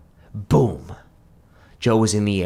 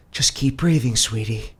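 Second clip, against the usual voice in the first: soothing paramedic; 6 semitones higher, 4 dB louder than usual. A narrator's reading. Paramedic comforting Joe.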